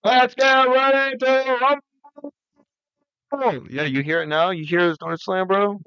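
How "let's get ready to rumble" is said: drawn-out, higher boxing announcer intro; ring announcer call. Pretend. Announcing a pretend fight in the hallway, hammed-up ring announcer. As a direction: stretch it like a fight-night announcer. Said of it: "ring announcer call".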